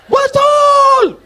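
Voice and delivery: very high, loud shout; shrill yelled answer. Acted. Audience member shouting agreement to the preacher, very high and loud. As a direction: yell it back from the crowd.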